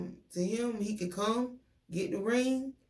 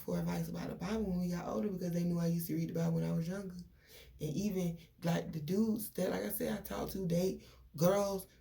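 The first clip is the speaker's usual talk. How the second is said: usual speaking voice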